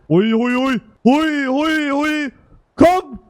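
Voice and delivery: high cheering that ends in a loud shout; cheering on the landing plane; excited cheering. Real, not acted. Cheering friend's plane to land.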